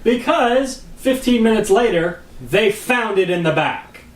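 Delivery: "Because fifteen minutes later" stretched high and slow; emphatic punchline. Real, not acted. Husband justifying his wife's persistence, emphatic on "found it in the back". Triumphant punchline answering a challenge.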